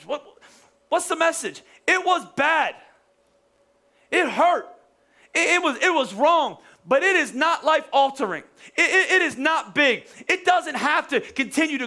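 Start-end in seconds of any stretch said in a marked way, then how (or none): 1.9-10.1 higher-pitched, punched preaching; emphatic proclamation. Real, not acted. Preacher declaring the message to the hurting, emphatic. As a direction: drive each short statement home.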